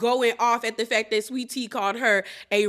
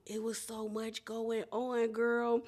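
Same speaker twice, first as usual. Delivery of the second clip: much softer, slow; weary aside; 3 semitones lower, 12 dB quieter, slower than usual. Real, not acted. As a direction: trail off, worn out. Host sighs at chaos.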